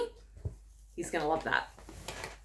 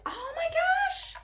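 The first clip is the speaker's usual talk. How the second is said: very high, drawn-out squeal; amazed squeal; much slower than usual. Real, not acted. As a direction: squeal slowly in sheer amazement. Overjoyed at a surprise gift.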